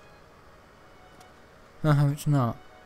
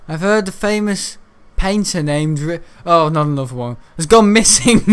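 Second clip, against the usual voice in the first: mock quest read-out; 4 semitones higher, 6 dB louder than usual. Gamer reading a quest in a mock dramatic voice. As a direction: ham up the quest text. Reads quest text aloud.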